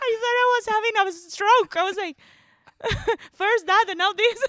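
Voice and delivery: high, fast, laughing; laughing disbelief. Real, not acted. Laughing at cohost's hotel mix-up, high and fast. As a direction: blurt it out through laughter.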